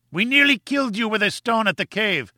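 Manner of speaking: high, frantic taunting cry. A narrator's reading. Gomez crying out to the explorers, frantic high taunt. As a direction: fling it across the gap in frenzy. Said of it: "he cried".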